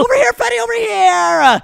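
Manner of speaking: high-pitched call for a pass; urgent call-out. Real, not acted. Player calls Freddy for the pass, urgent high call. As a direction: call across the court, pitch jumping up. Calling teammate for the ball.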